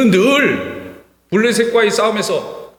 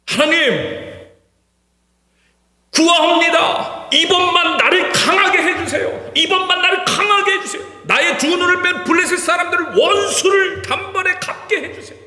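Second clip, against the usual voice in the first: desperate crying out; 8 semitones higher than usual. Acted. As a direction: cry out to God with everything you have. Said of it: "간절할 수밖에 없어요".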